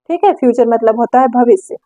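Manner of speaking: ordinary talk, nothing marked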